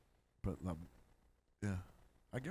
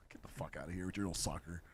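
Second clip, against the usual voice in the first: ordinary talk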